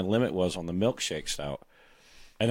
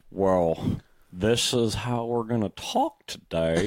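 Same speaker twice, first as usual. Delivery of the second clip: slow drawl; slower than usual. Shown as a demonstration. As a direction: draw the words out lazily. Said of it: "I get my slow drawl".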